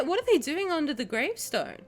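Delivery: usual speaking voice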